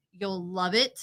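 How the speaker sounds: normal talking voice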